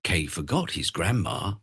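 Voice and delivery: ordinary delivery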